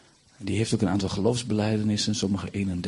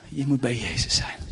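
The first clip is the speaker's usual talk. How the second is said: ordinary talk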